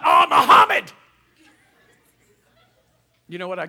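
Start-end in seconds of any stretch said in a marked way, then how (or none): 0.0-0.9 very high, loud mock cry of Oh, Muhammad; shouted mock oath. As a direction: yell it like a startled curse. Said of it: "what about".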